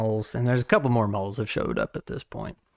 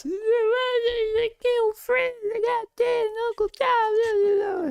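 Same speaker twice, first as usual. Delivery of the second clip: high, slow wailing sobs; wailing kid voice, much higher and slower; sobbing kid; much slower than usual. Acted. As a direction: cry it out like a heartbroken child. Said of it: "he's just a mess".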